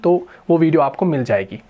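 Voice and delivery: ordinary talk, nothing marked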